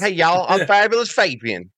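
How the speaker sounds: a touch of hammy Southern twang; Southern accent. Retold or imitated. Co-host joins in, a light Southern impression. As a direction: introduce yourself like a Southern wrestler. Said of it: "horrible Southern".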